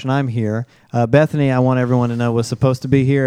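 ordinary talk, nothing marked

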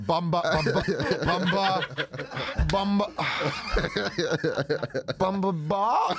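lightly voiced Jamaican accent with laughter. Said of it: "the Jamaican accent thing".

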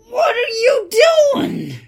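high, loud shriek of disbelief; appalled high-pitched yell; shocked outburst. Acted. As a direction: cry out, aghast at the mess. Catches character raiding the minibar.

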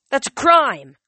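loud, high outraged yell. A narrator's reading. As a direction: yell it across the pier. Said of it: "one of the other homeless guys yelled".

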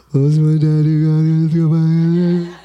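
put-on slow, loud dad voice to the baby; playful dad. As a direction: ask the baby playfully, drawn out. Father talking to unborn baby.

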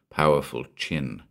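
usual speaking voice

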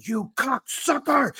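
high strained scream at the car, slowed and drawn out; retold yell. Cursing a frozen car at the neighbours, shrieking high and slow.

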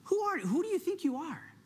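high-pitched voice of the accuser; accusing enemy. Acted. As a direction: challenge them as the taunting enemy. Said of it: "the enemy loves to stir up the question".

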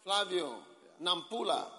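loud, high calling out; yelling across the room. Real, not acted. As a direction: call it out across the hall. Calling places loudly.

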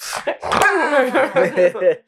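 high wheezing laughter; laughing hard. Real, not acted. Friends joking about Garp on set, laughing hard. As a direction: lose it laughing.

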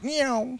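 high-pitched car zoom noise; imitated car swerve. Teasing that the car drove itself, imitated high zoom.

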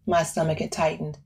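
ordinary delivery

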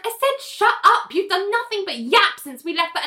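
exasperated, hammed-up telling-off; retold angry retort. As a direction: imitate someone at the end of their patience. Acted squabble, angry retort.

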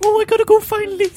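squeaky camp pop star chatter; high campy character voice. Pretend. [name] going to find [name], high and camp.

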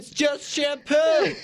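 high, slow commercial parody; mock ad voice. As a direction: draw out the ad line, high and silly. Mimicking the shampoo ad just played.

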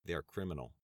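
composed again after the outburst; calmer tone. Acted. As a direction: rein yourself in and say it calmly. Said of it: "repeated in a calmer tone".

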